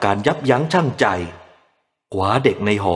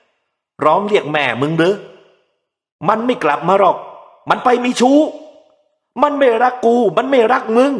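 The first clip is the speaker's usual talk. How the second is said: put-on bitter drunken rant; 12 semitones higher, 3 dB louder than usual. A narrator's reading. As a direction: wail the betrayal at the baby. Drunk father ranting about wife.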